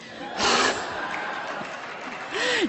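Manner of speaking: a high, breathless giggle; airy laugh. Real, not acted. Admitting she loves chocolate, airy giggle. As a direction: laugh at her own confession.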